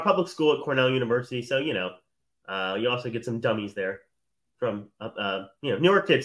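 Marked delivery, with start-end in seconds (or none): none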